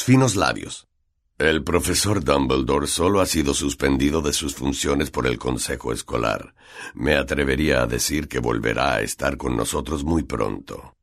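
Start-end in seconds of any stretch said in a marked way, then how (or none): 1.4-10.9 much lower-pitched teacher voice; Snape. A narrator's reading. Snape answering Malfoy about Dumbledore's suspension, low voice. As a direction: drop the pitch into a restrained, official answer. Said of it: "dijo Snape".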